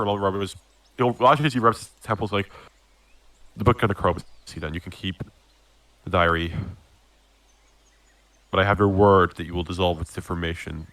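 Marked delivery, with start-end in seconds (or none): 3.6-10.9 lightly voiced low, measured captain; solemn captain. Captain addresses Glint about the goddess's word, lightly voiced solemnity. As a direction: lower your voice slightly for a grave officer.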